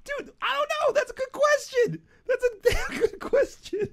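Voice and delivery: high, laughing through the words. Real, not acted. Cohost laughing helplessly at a good question, high and laughing. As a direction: crack up while talking.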